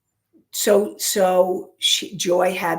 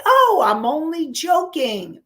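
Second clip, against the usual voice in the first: higher, louder mocking of a flippant excuse; mock-innocent "only joking"; 8 semitones higher, 4 dB louder than usual. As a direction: parrot the brush-off with a sneer. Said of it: "people say".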